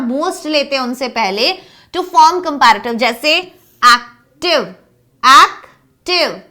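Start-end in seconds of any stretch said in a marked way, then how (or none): 3.4-6.4 slow, split into two clear beats, "एक्" then "टिव"; syllable by syllable. Shown as a demonstration. Teacher showing two-syllable adjectives, syllable by syllable. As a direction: say "active" in separated syllables.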